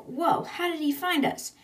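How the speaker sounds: high-pitched, amazed retelling; startled question. Retold or imitated. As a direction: act out astonishment with a lifted voice.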